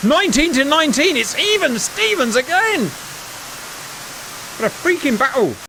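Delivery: very high-pitched, excited score call; thrilled commentary. Real, not acted. Commentator announcing the battle is even again, thrilled. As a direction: cry out in amazement at the tie.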